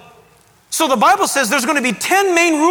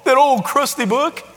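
mock disbelief at a believer; incredulous, belittling mimicry; scoffing skeptic. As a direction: put on a dismissive scoffer's voice. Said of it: "when somebody says".

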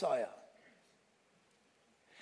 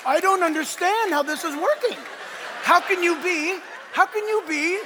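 higher and louder, laughing in disbelief; baffled seller; 6 semitones higher, 4 dB louder than usual. Retold or imitated. Seller can't grasp a Gentile knowing this, disbelieving. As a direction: sputter as if the world just flipped.

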